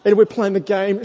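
normal talking voice

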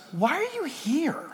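soft, slow, high-pitched question; gentle question. Acted. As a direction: ask softly and slowly, as gentle God. Acts God gently asking Elijah.

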